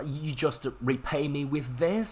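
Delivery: high, slow, indignant accusation; mock argument. Teacher acting out a serious argument, indignant reproach. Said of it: "some serious argument".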